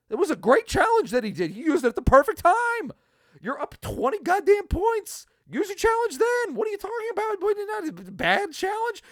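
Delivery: high, loud furious tirade; angry rant at the heckling fan. Retold or imitated. Ranting back at fan.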